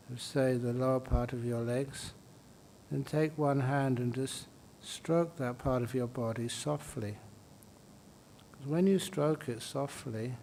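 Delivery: soft, unhurried meditation voice with long pauses; guided meditation. Real, not acted. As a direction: gently guide them through the body, softly. Leads a body-scan meditation.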